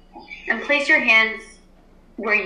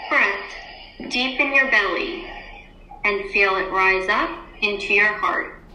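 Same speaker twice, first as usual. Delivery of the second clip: guided meditation. Acted. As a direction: ease the class into stillness. Teacher leads class meditation.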